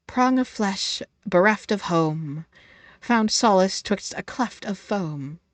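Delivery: slow, dramatic poetry reading; mock poem recital. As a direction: recite it like solemn verse.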